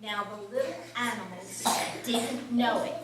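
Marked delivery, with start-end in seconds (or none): none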